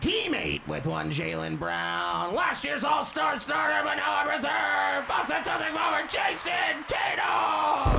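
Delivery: sports announcer. Acted. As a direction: call the name out to the arena. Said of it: "if I were introducing some players".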